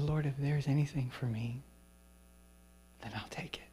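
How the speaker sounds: hushed giving-in prayer; quiet prayer. Retold or imitated. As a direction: let it go almost under your breath. Surrendering prayer in his heart.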